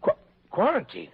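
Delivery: stuttered, disbelieving question; stammering shock. Acted. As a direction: stumble over it in shock. Owner shocked by quarantine.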